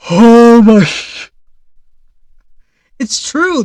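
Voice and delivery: loud, high, slow, cut-off exclamation; loud stunned gasp of a phrase; shocked outburst. Real, not acted. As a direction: blurt it in delighted shock. Reacting to a joke statement.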